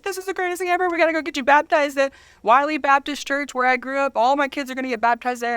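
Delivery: high, gushing mock excitement; excited quote. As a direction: imitate her bubbling over with joy. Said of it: "And she's like".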